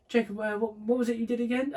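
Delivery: high-pitched mock mum asking again; imitating mum. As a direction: ham up a confused mum. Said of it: "[name] does the best impression of me".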